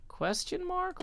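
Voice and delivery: "Question mark?" swooped up very high; rising intonation. Shown as a demonstration. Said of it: "Rising intonation".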